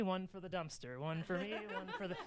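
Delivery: softly, secretly counting out pieces; sneaky hushed counting. Retold or imitated. Sneaking cookie dough by the dumpster, hushed and sly. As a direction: murmur it like a guilty snacker.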